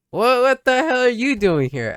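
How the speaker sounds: high, loud, slow shocked cry; startled outburst. Acted. Startled girl shouting at unexpected visitor, shocked. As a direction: blurt it out in total shock.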